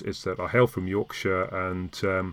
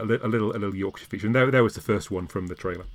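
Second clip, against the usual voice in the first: normal talking voice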